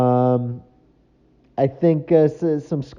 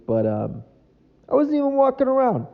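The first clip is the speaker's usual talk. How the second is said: usual speaking voice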